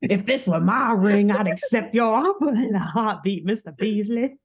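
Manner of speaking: proud Southern gentleman; Southern accent. Acted. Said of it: "But he had n".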